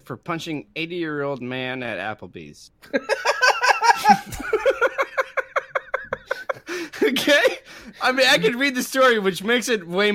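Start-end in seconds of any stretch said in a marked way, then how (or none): 2.9-7.0 long high burst of real laughter; helpless laugh. Real, not acted. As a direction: crack up completely at the headline. Cracks up at the headline.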